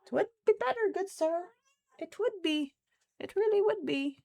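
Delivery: high, loud mock-posh pleading; owner's pleading voice. Owner voicing herself pleading with the cats, mock posh. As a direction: plead with the cat, hammed up. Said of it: "And it's like".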